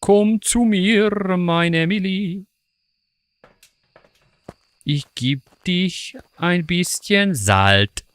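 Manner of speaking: high, slow coaxing German; slow, high mock-seductive German call; luring ghost call. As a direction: coax the ghost closer, drawn out.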